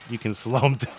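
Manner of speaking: normal talking voice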